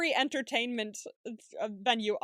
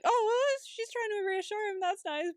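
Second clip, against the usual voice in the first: high voice easing downward; pleased first reaction; 9 semitones higher than usual. Retold or imitated. Recalling watching Maggie reassure him, high pleased reaction. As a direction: re-enact a charmed, naive reaction.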